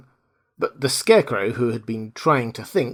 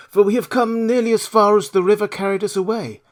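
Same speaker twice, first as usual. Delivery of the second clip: higher, lighter Scarecrow voice; 8 semitones higher than usual. Acted. Scarecrow tells the girl they are near the road, higher voice. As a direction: play the straw man, chatty.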